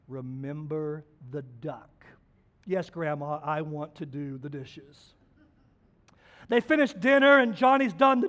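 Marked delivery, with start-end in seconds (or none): 0.1-2.0 low, soft, slow menacing hint; quiet threat. Retold or imitated. Sister threatening Johnny softly, low and slow. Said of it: "And Johnny goes". 2.7-5.1 put-on resigned boy. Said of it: "And Johnny goes".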